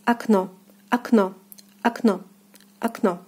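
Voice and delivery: "окно" said ak-NO, stress on the second syllable; "окно" in separate model takes; Russian pronunciation. Shown as a demonstration. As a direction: begin "окно" on ah, end on a full O. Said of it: "So the word is pronounced as".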